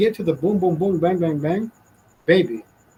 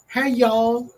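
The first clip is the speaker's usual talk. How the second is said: higher-pitched, drawn-out Southern "y'all"; imitated Southern greeting, pitched up; mock Southern accent; 6 semitones higher than usual. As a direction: put on a Southern drawl to mock TikTokers. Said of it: "have the fucking Southern accent".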